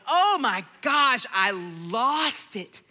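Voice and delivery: ordinary delivery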